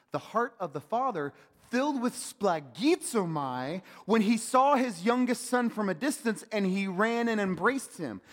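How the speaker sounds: full-force preaching. Acted.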